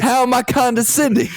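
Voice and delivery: high-pitched, indignant mobster parody; mafia tough guy. Acted. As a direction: challenge them like a hammed-up mobster. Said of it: "talking like you were in the mafia".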